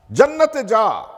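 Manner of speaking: high, loud acted rebuke; sharp preacher's scolding, pitched well up; scolding command. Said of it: "ধমক দিয়ে বলবেন".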